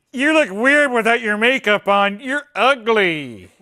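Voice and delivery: put-on high, loud mean-girl sneer; mock mean girl. Imitating the contestant insulting Chrissy, high, loud and sneering. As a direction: sneer it like the bully. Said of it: "she's just like".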